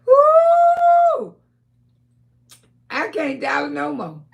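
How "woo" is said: high loud whooping cry; delighted whoop. Real, not acted. Auntie whooping at her live viewers in delight.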